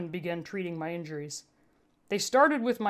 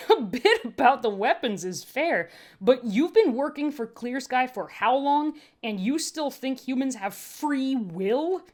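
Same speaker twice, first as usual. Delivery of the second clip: raised, higher and louder voice of a scoffing medic; scoffing medtech; 6 semitones higher, 5 dB louder than usual. A narrator's reading. Medtech mocks the sec unit's belief in free will, higher and louder. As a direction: push back, amused and incredulous.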